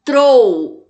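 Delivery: "Trou" loud and drawn out; isolated stressed syllable. Shown as a demonstration. Teacher naming the most intense syllable, "Trou" stressed loudly. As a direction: punch "Trou" and hold it. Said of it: "a sílaba com maior intensidade".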